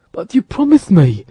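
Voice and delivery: ordinary delivery, nothing marked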